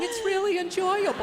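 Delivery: high mock-sincere insistence; lying gold digger. Young wife lying about her old husband, high mock-sincere voice. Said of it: "they always try and lie".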